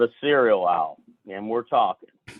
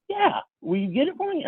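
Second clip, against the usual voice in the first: put-on very high, childlike eager request; eager pleading imitation; 14 semitones higher, 3 dB quieter than usual. Friend asking to be bought syrup, acted eagerness.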